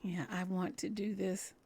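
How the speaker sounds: ordinary talk, nothing marked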